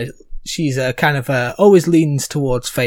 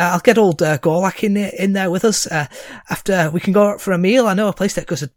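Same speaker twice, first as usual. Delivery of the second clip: high, quick hammed-up Scouse voice; horrible Scouse accent; 5 semitones higher than usual. GM reading a message from Bernard Fendek in a Scouse accent. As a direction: ham up a cheesy Scouse lad. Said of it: "let me put on my horrible Scouse accent".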